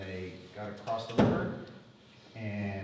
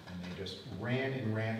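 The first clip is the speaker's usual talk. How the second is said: ordinary talk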